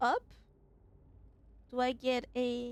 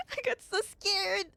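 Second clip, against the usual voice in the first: laughing; 7 semitones higher than usual. Real, not acted. Laughing after a scare in game.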